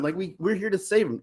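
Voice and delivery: ordinary delivery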